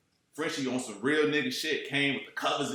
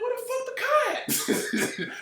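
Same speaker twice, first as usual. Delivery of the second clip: high, slow, disbelieving cry with a laugh; lost, half laughing, can't find the car; baffled outburst; slower than usual. Retold or imitated. Searching for missing car, confused.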